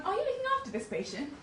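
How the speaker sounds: high-pitched imitation of the nurse; mimicked nurse. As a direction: play the nurse asking politely. Nurse voiced in story.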